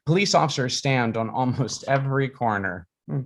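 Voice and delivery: ordinary delivery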